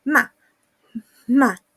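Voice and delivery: "Ma" short, cutting and loud; angry fourth tone. Shown as a demonstration. Teacher demonstrating the angry tone, short cutting "ma". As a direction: chop "ma" off firmly with a sharp drop. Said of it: "el tono de enojo".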